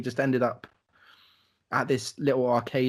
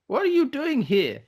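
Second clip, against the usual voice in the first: high-pitched, louder challenge; imitated challenger; 11 semitones higher, 4 dB louder than usual. Clubgoer confronts three outsiders, high-pitched imitated challenge. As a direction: put on a high voice, confronting intruders.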